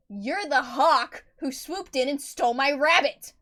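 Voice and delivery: loud slow snarl of accusation; furious slow snarl. Acted. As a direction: snarl it out, hold each word. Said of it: "she snarled".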